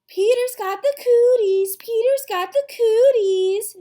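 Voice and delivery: loud, high playground chant; girl's taunting yell. A narrator's reading. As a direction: yell it tauntingly like a little girl. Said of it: "she yelled".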